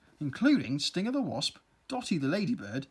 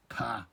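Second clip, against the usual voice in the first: ordinary delivery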